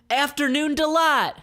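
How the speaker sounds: very high, loud crooning voice; Mick the sailor. Acted. Sailor Mick introduces his band, very high and loud. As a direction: belt it like a campy lounge sailor. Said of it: "says a guy named Mick".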